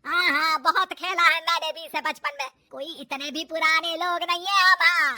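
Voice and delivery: loud, huffy mother-in-law; forceful huffy boast; indignant retort. Acted. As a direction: answer back loudly, offended.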